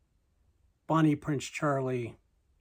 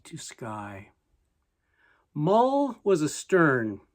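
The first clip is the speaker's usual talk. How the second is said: measured, louder poetry reading that falls away at line ends; poem recitation; 4 semitones higher, 5 dB louder than usual. Acted. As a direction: declaim the verse with higher, ringing line openings. Recites Stevenson's poem aloud.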